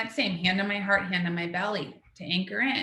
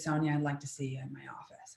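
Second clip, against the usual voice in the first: quiet whispered call to the office; hushed summons; 5 semitones lower, 9 dB quieter than usual. Retold or imitated. Principal summons her after announcements.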